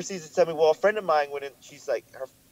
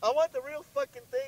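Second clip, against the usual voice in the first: high-pitched insistent protest; heated insistence. Real, not acted. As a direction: demand it, voice pitched way up. Heated objection in debate.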